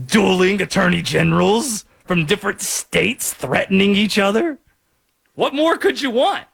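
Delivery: quoting a favorite SNL character, playfully; Stefan impression. Host quotes Stefan's club pitch to co-host, light impression. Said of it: "to quote Stefan from S N L".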